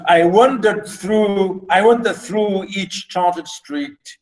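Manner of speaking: slow, each beat of the line marked; reading with metrical stress. Shown as a demonstration. Teacher reading the London poem to the class, slow and stressed. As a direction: read "I wandered through" slowly, leaning on every beat.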